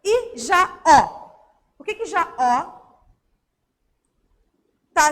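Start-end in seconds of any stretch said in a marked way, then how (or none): 0.4-1.3 "já ó" slow and loud, split in two; separated vowels. Shown as a demonstration. Teacher showing a hiatus, "já ó" pulled apart loudly. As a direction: stretch "já ó" into two distinct syllables. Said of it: "Porque as vogais separaram".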